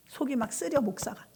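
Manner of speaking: ordinary talk, nothing marked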